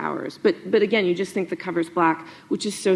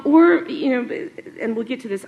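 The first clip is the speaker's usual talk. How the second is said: ordinary talk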